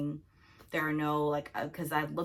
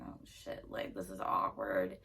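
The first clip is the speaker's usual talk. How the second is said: low, hushed mutter; awkward aside; 9 semitones lower, 6 dB quieter than usual. Retold or imitated. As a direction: mutter it to yourself, uncomfortable. Awkward aside while standing by.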